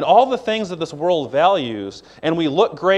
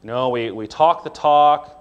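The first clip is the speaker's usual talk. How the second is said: ordinary talk, nothing marked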